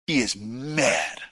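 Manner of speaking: "mad" drawn out, low and slow; emphatic. A narrator's reading. Storyteller revealing the trainee's anger, slow low stress on "mad". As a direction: lean slowly on "mad".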